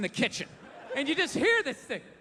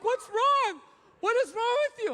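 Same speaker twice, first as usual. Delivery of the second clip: high, alarmed imitation; mock panicked demand, pitched way up; freaking-out wife; 10 semitones higher than usual. Wife alarmed at pacing husband, imitated high and frantic. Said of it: "freaking out".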